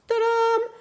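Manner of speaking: high triumphant ta-da; ta-da reveal. Real, not acted. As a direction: announce the reveal brightly. Reveals finished card. (ta-dam triomphant et aigu)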